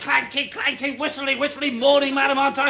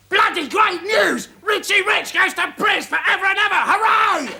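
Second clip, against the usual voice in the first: crowing, higher-pitched newspaper headline; gleeful mock headline; 4 semitones higher than usual. Imagining the paper's verdict on Richie, gleeful mock headline. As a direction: proclaim it like a triumphant front page. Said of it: "Daily Telegraph".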